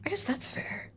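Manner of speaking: breathy whispered concession. Real, not acted. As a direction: whisper it to yourself. Repeats line, then whispers.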